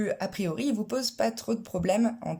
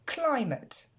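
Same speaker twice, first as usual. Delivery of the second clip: Climate, higher, stress on Cly; stress on first syllable; 7 semitones higher than usual. Shown as a demonstration. Teacher repeats Climate with English stress. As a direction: Climate as klaɪmət. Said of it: "Encore une fois".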